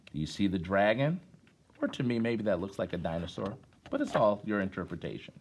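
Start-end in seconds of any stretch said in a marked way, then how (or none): none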